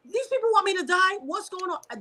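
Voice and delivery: high, tearful disbelief; reliving it, pitched high and upset; alarmed disbelief. Retold or imitated. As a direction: voice climbing in hurt alarm. Retold panicked thought, voice breaking.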